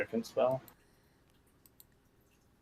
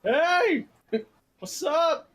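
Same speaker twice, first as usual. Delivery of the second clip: much higher, loud hey; excited greeting; 8 dB louder, much slower than usual. Real, not acted. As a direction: squeal a delighted hello. Greets summoned wolf.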